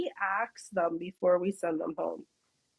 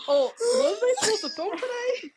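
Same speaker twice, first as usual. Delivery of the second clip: high confused C J; 12 semitones higher than usual. Retold or imitated. C J walking in late, asking the team, high and puzzled. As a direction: pitch way up, puzzled and laughing. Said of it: "He'll be coming in like".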